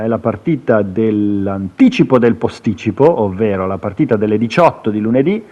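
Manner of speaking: normal talking voice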